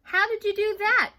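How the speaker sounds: high, loud amazed question; amazed exclaim. A narrator's reading. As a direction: gasp it out in wonder. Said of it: "Darcy exclaimed".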